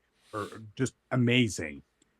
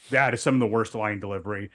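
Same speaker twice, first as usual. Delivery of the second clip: ordinary talk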